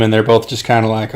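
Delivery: ordinary delivery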